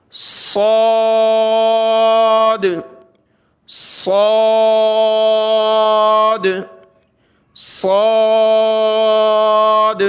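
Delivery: "صاد" with whistled s and dark, long rounded vowel; thick, whistling. Shown as a demonstration. Teacher contrasts with thin seen, "صاد" thick and long. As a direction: hiss then round "صاد" heavily, held. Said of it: "صاد کو موٹا پڑھیں گے".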